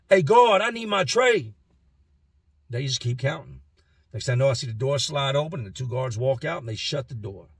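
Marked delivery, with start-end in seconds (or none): 0.1-1.5 calling out. Retold or imitated. Inmate calling to the guards.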